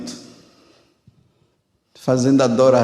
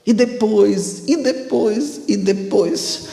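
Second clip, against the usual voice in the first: mimicking; 7 semitones higher, slower than usual. Soldier mimicking the priest.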